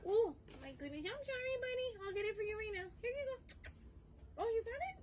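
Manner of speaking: pet talk. Real, not acted. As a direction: gush over the dog. Apologising and feeding dog.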